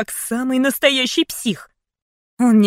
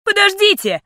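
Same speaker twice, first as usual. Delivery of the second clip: high loud cry for attention; shouting; 11 semitones higher, 5 dB louder than usual. Acted. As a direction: yell after someone leaving. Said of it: "прокричала я".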